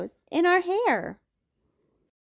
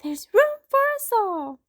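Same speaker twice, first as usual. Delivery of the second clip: cheerful mom; 14 semitones higher, 5 dB louder than usual. Acted. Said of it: "said Mom".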